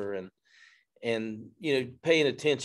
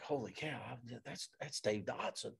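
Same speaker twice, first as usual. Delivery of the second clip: hushed, starstruck murmur much softer; awed hush; 15 dB quieter than usual. Retold or imitated. Starstruck at spotting Dave Dotson, hushed awe. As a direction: breathe it out in awe.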